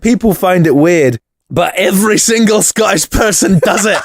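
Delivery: hammed-up Scottish accent. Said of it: "terrible Scottish accent".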